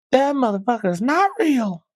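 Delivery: high, strange put-on voice of a stunned passenger; alien-sounding lady. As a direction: squeal it like she can't believe her eyes. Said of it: "she sounded like an alien on the plane".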